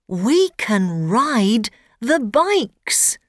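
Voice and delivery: put-on eager little boy; young boy voice. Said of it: "says Ben".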